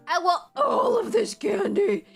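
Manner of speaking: high, slow, put-on greedy voice; hammed-up, drawn-out craving; greedy candy lover. Shopper at a magical candy store, greedy mock voice.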